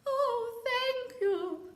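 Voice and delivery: high, bright thank-you voice; grateful mermaid. Acted. Mermaid thanking Pearl, high and grateful. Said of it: "said the mermaid".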